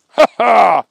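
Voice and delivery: loud hammed-up villain-style ha ha; gloating laugh. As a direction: bark out a triumphant ha ha. Agent gloats over arrest.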